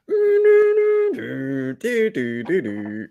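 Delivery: high beeping fax machine noises; fax machine impression. Shown as a demonstration. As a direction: imitate a fax connecting. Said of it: "an impression of a fax machine".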